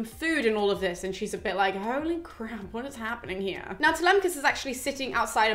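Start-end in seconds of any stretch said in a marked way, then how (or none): 1.8-3.8 softer, mock-stunned aside; lightly acted hushed astonishment; quietly stunned. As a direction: voice her disbelief under your breath. Acted stunned reaction to suitors.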